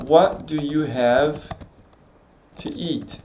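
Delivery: very slow, careful English question; slow practice line. Shown as a demonstration. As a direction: drag each word out for a learner. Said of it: "Aí eu posso perguntar".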